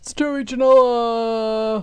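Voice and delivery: drawn-out announcer call of the name; ring announcer. Pretend. Co-host introduces wrestler Joey Janela, mock ring announcer.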